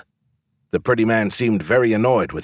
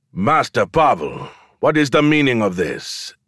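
booming voice; 3 semitones higher than usual. A narrator's reading. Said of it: "a powerful thing that boomed across the courtyard".

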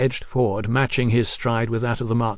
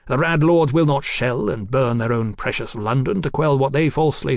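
put-on fiery young revolutionary; 3 semitones higher than usual. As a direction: preach it like a zealot on a soapbox. Marquis preaching revolution.

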